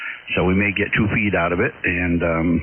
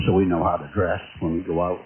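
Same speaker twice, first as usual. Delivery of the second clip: usual speaking voice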